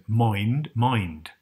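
"Mind" said with a rounded, almost O-like vowel; "mind" drawn out with an O colouring the vowel; West Country mind. Retold or imitated. Quoting the actor's vowel for viewers, "mind" with an O sound. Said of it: "You hear him say".

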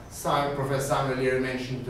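normal talking voice

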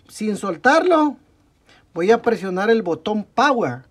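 usual speaking voice

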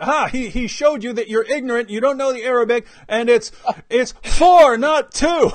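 loud, high imitation of a triumphant heckler; mock gloating. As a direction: crow it mockingly, blasting a stretched "for". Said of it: "I still get comments from Muslims".

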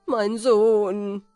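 lament, building from a moan to a cry; weeping cry.

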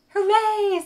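high, loud cheer; a happy cheer from all the friends. A narrator's reading. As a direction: shout hooray with joy.

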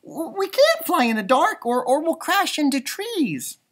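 high, stammering frightened voice; a nervous young bat fretting about the dark; scared little bat. Acted. Stella Luna fears crashing into trees in the dark.

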